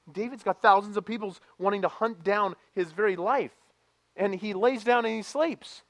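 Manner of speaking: loud, high, fervent sermon voice; full-force preaching. Acted. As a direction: preach it out to the church. Preaching climax.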